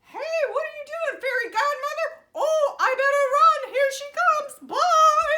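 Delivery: high, loud, fast and flustered; startled shout. Acted. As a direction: caught out, panicking, rushing away. Godmother caught, runs off.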